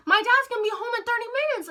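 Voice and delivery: high, fast retold panic; freaking out. Teller to friend as dad's return nears, high rushed panic. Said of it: "freaking out and everything".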